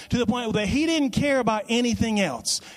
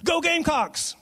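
higher-pitched imitation of a fan's cheer; toned-down game-day yell, pitched up; mild mock yell; 8 semitones higher than usual. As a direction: cheer it like a fan, without really shouting. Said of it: "yell at the top of her lungs".

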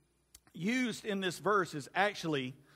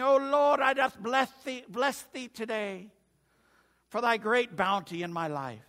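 a light put-on English accent, like an old hymn; mock English accent; 3 semitones higher than usual. Pastor jokingly quoting scripture in a mock English accent. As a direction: lightly ham up an English churchman's prayer. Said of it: "it sounds like an Englishman".

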